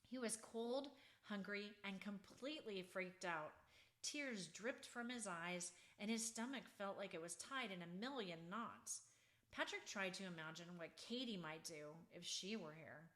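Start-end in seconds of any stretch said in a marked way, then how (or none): none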